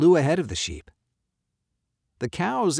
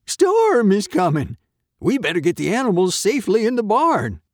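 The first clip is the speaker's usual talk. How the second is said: loud, high warning from the farmer; farmer's bellow; 10 semitones higher, 8 dB louder than usual. A narrator's reading. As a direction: call the warning out urgently. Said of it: "he bellowed".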